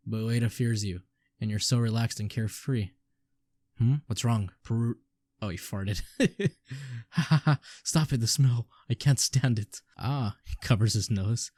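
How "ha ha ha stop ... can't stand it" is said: laughing through the words; can't stop giggling. Acted. Laughing at a fart.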